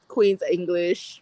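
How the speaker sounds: slow, prim Queen's English; proper Queens English. Shown as a demonstration. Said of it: "wanted me to speak proper English".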